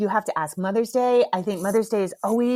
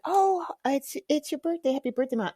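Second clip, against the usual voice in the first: Pascal; 6 semitones higher than usual. Retold or imitated. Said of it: "then Pascal's like".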